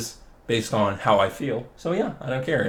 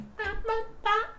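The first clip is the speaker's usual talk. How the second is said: high squeaky giggle burst; high squeal laugh; 9 dB quieter than usual. Real, not acted. Vlogger cracking up at own bit, with a high squeal laugh. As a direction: let out a high-pitched giggle.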